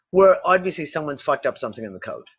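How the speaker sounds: ordinary delivery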